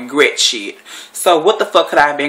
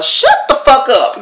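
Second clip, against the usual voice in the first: loud, high, emphatic retelling of a yell; re-enacted exasperated shout; fed-up outburst; 10 semitones higher, 5 dB louder, slower than usual. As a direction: punch it slowly, word by word. Repeats what she told her.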